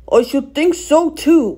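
slow, indignant reproof. A narrator's reading. Fat controller scolds Donald, slowly and indignantly. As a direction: say it slowly, with affronted authority. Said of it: "went on the fat controller indignantly".

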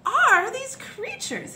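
high, slow outraged bellow; flabbergasted duke's roar. A narrator's reading. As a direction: bellow the question in disbelief. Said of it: "he bellowed".

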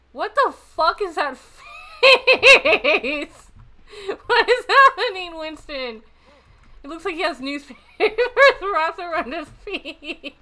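high, loud words breaking into laughter; laughing hard. Real, not acted. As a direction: squeal it out through real laughter. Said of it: "pain in my boob from laughing".

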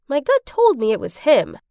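ordinary delivery, nothing marked

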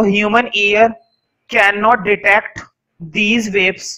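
slow, separated dictation phrases. Real, not acted. Teacher dictating the next note to students, slow dictation. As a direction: pause between chunks for writers.

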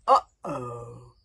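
low, loud groaning uh-oh; dismayed uh-oh. A narrator's reading. Hiccup seeing the dragon rise, low dismayed groan. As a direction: realise it has all gone wrong.

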